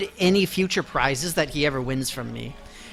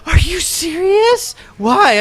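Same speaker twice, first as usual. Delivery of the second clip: high, loud, slow shocked question; mock shocked friend, loud; shocked disbelief; 11 semitones higher, 6 dB louder, slower than usual. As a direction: play the stunned friend. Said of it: "genuinely shocked".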